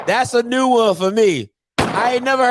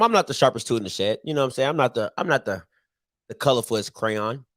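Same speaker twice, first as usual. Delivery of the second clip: lower, softer, fast throwaway aside; self-deprecating aside; 13 semitones lower, 5 dB quieter, much faster than usual. Real, not acted. Host joking about himself to listeners, quick low aside. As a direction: mumble it quickly, poking fun at yourself. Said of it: "I say this all the time".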